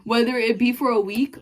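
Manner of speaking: ordinary talk, nothing marked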